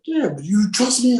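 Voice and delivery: very high, pressing imitation; retold urgent demand; insistent director. Director pressing him for trust.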